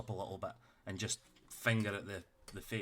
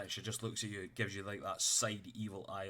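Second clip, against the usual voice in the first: usual speaking voice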